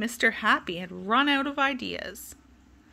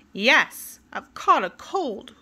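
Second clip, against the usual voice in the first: louder, grumpy complaint; grumbling; 3 semitones higher, 7 dB louder, slower than usual. Acted. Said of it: "grumbled Mister Grumble".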